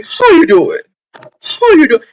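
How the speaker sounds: high, loud campy greeting; sassy drag queen "how you doing". Retold or imitated. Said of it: "like a drag queen".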